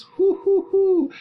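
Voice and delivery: high-pitched "hoo, hoo, hoo"; teasing uh-oh hoots; high hooting. Shown as a demonstration. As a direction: hoot in alarm, high and loud. Reacting to Juliet's defiance.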